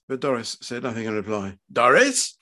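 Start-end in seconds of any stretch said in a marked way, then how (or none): 1.7-2.4 louder, higher questioning call; demanding husband, voice raised. Acted. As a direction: call your wife sharply for an answer. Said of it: "Fred's voice was raised".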